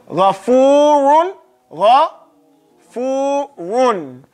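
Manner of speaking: slow Ghafurun with throaty gh; recited. Shown as a demonstration. Teacher reciting the word for students. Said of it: "say Gha".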